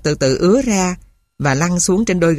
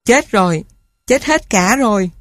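higher, a mild lament; mournful cry; 4 semitones higher than usual. A narrator's reading. Girl finding her dead siblings, understated mournful cry. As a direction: let it break out in grief. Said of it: "Bỗng em thốt lên ai oán".

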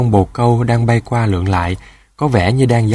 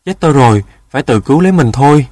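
quick, raised cry of alarm; frightened exclamation; 3 semitones higher, 5 dB louder, faster than usual. A narrator's reading. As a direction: blurt it out while fleeing. Rooster fleeing in fright. (hoảng sợ kêu nhanh)

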